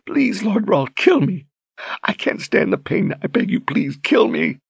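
high, tear-choked begging; weeping plea. A narrator's reading. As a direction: beg him in agony. Berdine begging through tears.

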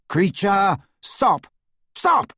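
high, loud commanding shout, building; shouting Harry. A narrator's reading. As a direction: shout to halt him, last word highest. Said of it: "shouted Harry".

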